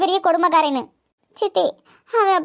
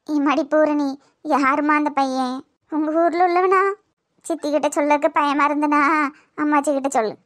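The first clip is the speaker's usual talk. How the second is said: gentle grandmother; 4 semitones lower than usual. Acted. (மென்மையான பாட்டி குரல், தாழ்ந்த தொனியில்)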